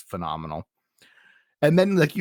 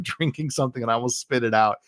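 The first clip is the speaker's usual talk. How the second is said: ordinary delivery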